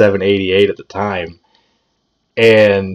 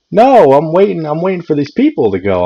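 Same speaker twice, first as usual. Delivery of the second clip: lightly voiced impatient great aunt, pitched higher; retold protest, higher and insistent; 7 semitones higher, 4 dB louder than usual. Retelling great aunt's impatient protest.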